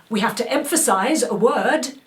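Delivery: loud, high, pushed stage delivery; over-emphasise every word so it reaches the back; theatrical emphasis. Shown as a demonstration. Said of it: "it's different to me saying".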